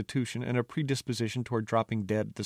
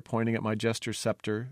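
ordinary delivery

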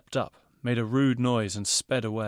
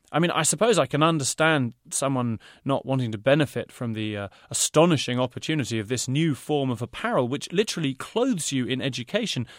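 salesman's pitch, a shade louder; sales patter; 4 semitones higher, 6 dB louder than usual. A narrator's reading. Designer praises his clothes, salesman's patter. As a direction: pitch it like a market trader. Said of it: "he cut this patter off".

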